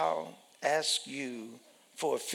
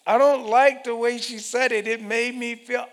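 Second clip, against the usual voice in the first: high, loud hurt complaint; aggrieved complainer; 9 semitones higher, 9 dB louder than usual. Acted. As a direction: whine about being wronged.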